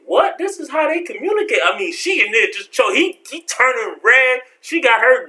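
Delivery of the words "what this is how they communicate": shocked disbelief. Retold or imitated. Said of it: "I'm just sitting on the couch like".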